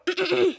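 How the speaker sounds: preparatory throat clear. Acted. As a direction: clear your throat before a big announcement. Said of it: "she's clearing her throat".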